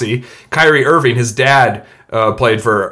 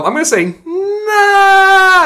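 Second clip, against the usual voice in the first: mock wail; much slower than usual.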